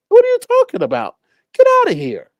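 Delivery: put-on very high, scoffing old man; mock denial. The old man scoffing at the police, very high. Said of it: "what he would have done".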